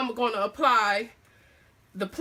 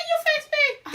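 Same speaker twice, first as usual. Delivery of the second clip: squeaky high voice; 4 dB louder than usual. Real, not acted. Surprised at her own voice.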